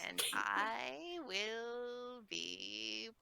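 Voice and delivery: slow, quiet little granny voice; old lady voice. Pretend. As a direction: talk like a frail grandma. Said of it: "how do I do old lady voice".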